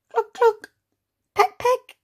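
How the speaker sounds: high squeaky clucking hen; hen noises. Shown as a demonstration. Teacher showing children a clucking noise, high and squeaky. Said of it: "Can you do, make a clucking noise?".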